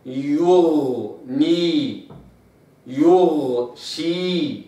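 very slow, lips rounded before the ghain in يغني and يغشي; held ghain, round lips. Shown as a demonstration. Teacher demonstrating ghain after damma, slow and rounded. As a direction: hold the غ long, lips back to neutral. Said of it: "فأقرأ قوله تعالى".

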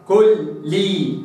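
"كل لي" slow, the two lams split; slow doubled lam. Shown as a demonstration. Teacher separating the lams in "كل لي". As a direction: give each lam in "كل لي" its own beat. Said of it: "هكذا".

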